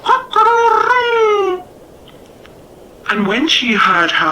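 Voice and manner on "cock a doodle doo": high, loud falling crow; hammed-up rooster crow; mock rooster crow. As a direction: crow like a cockerel to amuse your wife. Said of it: "And the cockerel said".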